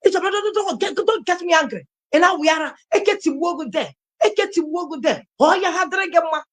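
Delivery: high-pitched angry outburst, retold; exploding mother. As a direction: act out a mother blowing up, pitch jumping high. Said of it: "my mother exploded".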